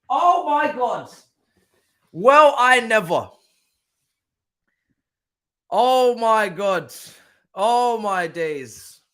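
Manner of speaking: very slow, high, loud disbelief; real astonishment. Real, not acted. Fan still stunned by a goal, drawn-out astonishment.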